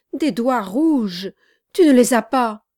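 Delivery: loud, high, accusing cry; cried out. A narrator's reading. Charlot confronting his companion over red finger marks, crying out. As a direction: cry it out forcefully at the culprit. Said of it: "cria Charlot".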